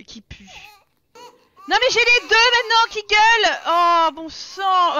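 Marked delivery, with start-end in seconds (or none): 1.7-5.0 loud, high exasperated cry; fed-up yell, dragging out the end; exasperated outburst. Real, not acted. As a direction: blow up in exasperation, voice pushed up. Exasperated at two screaming babies.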